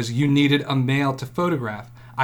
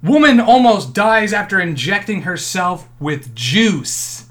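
louder, slower headline voice; news headline read; 4 semitones higher, 6 dB louder, slower than usual. Acted. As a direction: announce it like breaking news. Reading a headline aloud.

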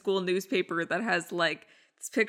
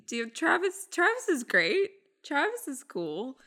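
higher, far-off unconvincing gushing; mock teen gush, pitched up; forced praise; 8 semitones higher than usual. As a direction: oversell a boyfriend you're not into. Imitating Lily praising Travis.

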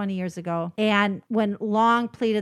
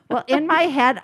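usual speaking voice

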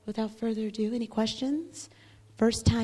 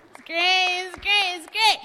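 each repeat higher and louder; building cheer; 6 semitones higher, 7 dB louder than usual. Real, not acted.

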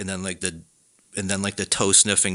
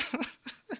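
high laugh; 12 dB quieter than usual. Real, not acted. Laughing at own story.

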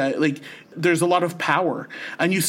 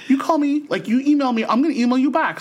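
higher, eager mock Amal; a light, raised-pitch impression of an eager friend; imitating Amal; 7 semitones higher than usual. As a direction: insist warmly, like an aunt giving her number. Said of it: "but totally".

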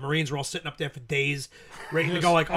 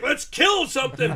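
mock eager Marine; 8 semitones higher, 4 dB louder, slower than usual. Quoting drunk Marines.

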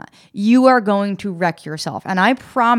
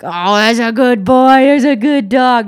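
slow, louder coo to a good dog; talking to a dog; 4 semitones higher, 6 dB louder, slower than usual. Retold or imitated. Passer-by cooing at a dog on the street, slow loving praise. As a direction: praise it like a sweet puppy. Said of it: "You're like".

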